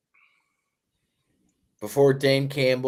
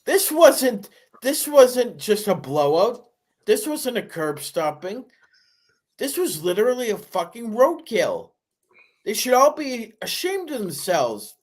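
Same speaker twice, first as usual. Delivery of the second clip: pitched way up, indignant and building; heated rant; 9 semitones higher than usual. Real, not acted. Cohost blasting the team for the blowout, heated and high. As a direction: climb high and hammer each "This wasn't". Cohost rants about humiliating loss.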